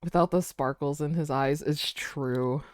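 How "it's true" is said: ordinary delivery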